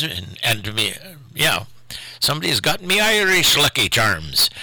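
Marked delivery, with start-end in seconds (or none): 2.2-4.5 put-on a modest stab at an Irish accent, lilting up high. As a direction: put on a cartoon leprechaun's Irish brogue. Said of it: "I don't do an Irish, uh, accent very well, but that's my best".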